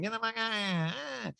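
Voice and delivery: put-on high, softened drunken whine; hammed-up boozy squawk; mock drunk diva. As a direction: replay the outburst as a slurred caricature. Flashback to drunk Erica's outburst.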